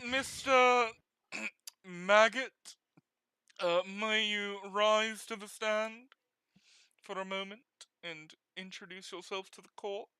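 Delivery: a hint of a smug British judge. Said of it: "making the scumbag guys British".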